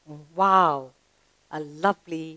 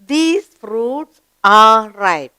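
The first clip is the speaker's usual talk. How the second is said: slow, deliberate reading with the verb filled in; slow model reading; much slower than usual. Shown as a demonstration. Teacher reads the corrected sentence slowly to pupils. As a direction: spell out the full sentence slowly. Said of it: "because there are three ripe mangoes".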